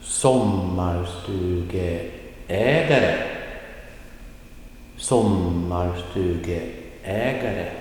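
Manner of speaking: slow, drawn-out Sommarstugeägare; slow demonstration. Shown as a demonstration. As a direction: model Sommarstugeägare slowly for learners to hear. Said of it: "Listen again".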